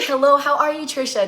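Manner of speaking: loud high hello; bright greeting. Real, not acted. Host greeting a viewer on her live stream, loud and bright. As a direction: call out a happy hello.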